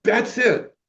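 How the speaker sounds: high, bright exclaim of discovery; excited realization. Retold or imitated. Remembering the moment it clicked, high excited cry. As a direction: burst out as it clicks. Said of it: "And I went".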